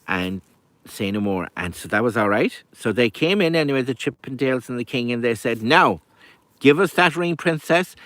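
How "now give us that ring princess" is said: raised, louder storybook ultimatum; forceful demand. A narrator's reading. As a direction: demand it firmly, pitch lifted. Suitors and king demand the ring.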